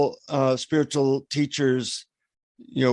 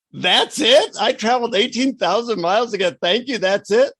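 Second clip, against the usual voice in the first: indignant disbelief; 7 semitones higher than usual. Retold or imitated. Disappointed traveler to guru after long trip, indignant. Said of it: "I'm totally pissed off".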